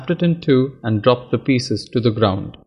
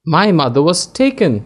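shouted; 6 semitones higher than usual. A narrator's reading. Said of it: "one person shouted".

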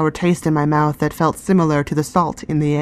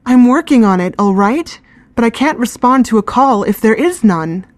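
higher and louder, upset near tears; a girl fighting back tears, voice raised; close to tears; 6 semitones higher, 5 dB louder than usual. Acted. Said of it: "She was close to tears".

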